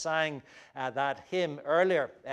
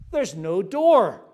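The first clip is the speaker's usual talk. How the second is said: surprised question; 3 semitones higher, 9 dB louder, slower than usual. Retold or imitated. Explorer's puzzled question.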